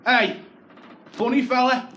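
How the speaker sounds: loud, high call from a cab; shouting across the street to him. Retold or imitated. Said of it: "this cab pulls up outside ours and goes".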